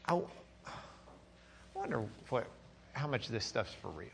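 soft, hesitant doubting voice; hushed inner doubt. Pretend. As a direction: murmur the doubt to yourself.

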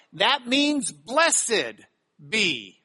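blessed drawn out very slowly; slow emphasis. Shown as a demonstration. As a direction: stretch "blessed" out, then drop on "be". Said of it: "picking up on it".

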